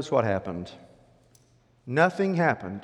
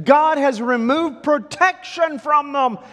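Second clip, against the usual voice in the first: high, loud, slow declaration; impassioned proclamation; 9 dB louder, slower than usual. Acted. Joshua assuring Israel God will give victory, impassioned. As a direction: proclaim it with full conviction. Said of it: "Joshua said".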